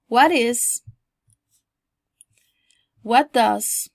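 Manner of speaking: loud, emphatic teaching model; model for repetition. Shown as a demonstration. Teacher recaps phrases for students to repeat, emphatic model. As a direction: punch each phrase out for learners. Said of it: "lo repitan de nuevo".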